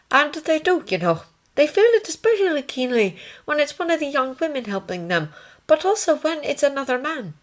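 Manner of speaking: high-pitched nursing sister; ward sister. Acted. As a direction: pitch it well up as a composed sister.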